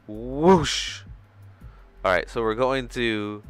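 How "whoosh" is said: loud, higher whoosh swelling and falling; imitated whoosh. Streamer imitating things going really fast, loud whoosh. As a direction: make a big swooping whoosh noise. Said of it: "They're like".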